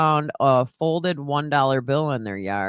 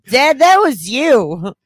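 put-on high, loud, slow little-kid retort; three-year-old's voice; 9 semitones higher, 9 dB louder, slower than usual. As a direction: accuse dad as an indignant toddler, drawn out. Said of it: "Out of a three-year-old voice".

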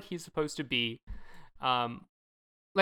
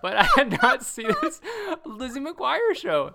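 mock dad; 5 semitones higher, 5 dB louder, slower than usual. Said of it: "quoting the dad's reply".

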